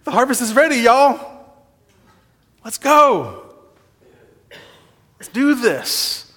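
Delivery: rally the troops, let's go; rallying call. Retold or imitated. Jesus rallying the church to the harvest, energized call. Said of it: "He turned to the church and said".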